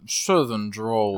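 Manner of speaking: slow, low mock southern accent; lazy, dropped-pitch southern attempt; southern drawl. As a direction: stretch it out in a fake southern drawl. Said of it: "give us that southern drawl".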